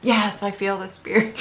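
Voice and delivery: soft, half-hearted psychic imitation; psychic's spirit act. Woman imitates the psychic sensing a spirit, hushed. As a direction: murmur it as if sensing a presence. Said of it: "I can't do it like she did".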